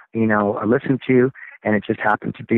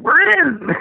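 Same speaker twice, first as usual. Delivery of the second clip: high, loud jubilant yell; retold whoop of instant agreement; eager acceptance; 4 dB louder than usual. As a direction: jump at the offer. Band's retold yes to a record deal.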